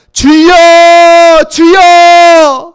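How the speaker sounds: long drawn-out wailing cry to the Lord; a drowning man's plea; desperate cry. Acted. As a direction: cry out for rescue at the top of your voice. Disciples crying out in storm.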